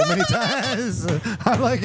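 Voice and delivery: high, slow words broken by laughter; real laughter while telling; laughing. Real, not acted. Laughing at own story.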